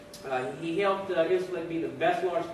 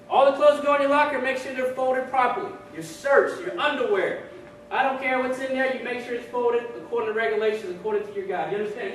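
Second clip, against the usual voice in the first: higher-pitched, forceful instructor; drill sergeant; 6 semitones higher, 5 dB louder than usual. Acted. Drill sergeant explaining lockers to recruits, firm commands. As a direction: rattle off orders like a drill sergeant. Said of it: "Drill sergeant says".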